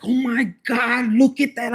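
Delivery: high, slow, loud gasped amazement; shocked. Acted. Pecker reacting in shock, high and drawn-out. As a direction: play it wide-eyed and drawn out. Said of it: "like shocked".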